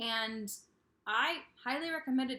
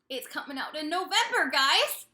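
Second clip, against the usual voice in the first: louder, with a big rising "guys!"; excited announcement; 4 semitones higher, 9 dB louder than usual. Real, not acted. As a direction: hype up the release date to viewers. Announcing an upcoming release.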